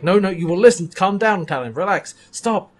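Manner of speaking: mild mock yell. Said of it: "yelling at Talon".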